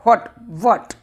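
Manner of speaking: what said short, with a half v at the start; pronouncing what. Shown as a demonstration. Teacher to class, demonstrating how to say what. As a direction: model the word what with a light v sound, then a clipped t. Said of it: "इसका pronunciation होता है what".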